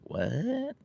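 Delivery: high, soft voice for a baffled dog; puzzled dog. Retold or imitated. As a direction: squeak it softly with a steep questioning rise. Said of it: "my dog used to look at me like".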